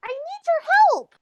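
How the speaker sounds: high-pitched in-character plea; Barry calling for help. Acted. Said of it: "and she goes".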